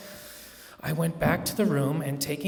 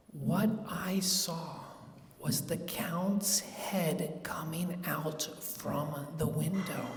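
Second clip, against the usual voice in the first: slow, softer, near whisper; hushed suspense; 11 dB quieter, slower than usual. A narrator's reading. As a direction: breathe it out as if afraid to be heard. Hushed moment spotting the Count.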